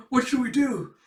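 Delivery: hammed-up student alarm; panicked question. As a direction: plead for a plan in a panic. Frightened student asks.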